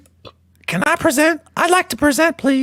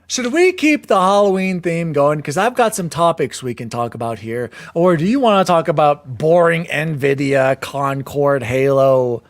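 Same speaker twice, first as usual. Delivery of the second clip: put-on creepy old man voice; 10 semitones lower than usual. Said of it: "keep doing my voice".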